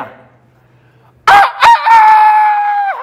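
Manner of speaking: very high, long crowing "아, 아, 아"; rooster crow. Shown as a demonstration. Priest imitates the rooster crowing after Peter's denial, very high drawn-out crow. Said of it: "하고 닭이 운 거예요".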